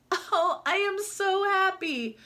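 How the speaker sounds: high, joyful sigh; pure delight in the bathtub; so happy. Acted. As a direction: duck overjoyed in the bathtub, high voice. Duck in the bathtub.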